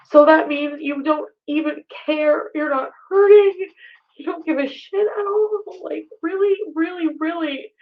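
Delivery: hurt voice climbing then collapsing; near tears. Real, not acted.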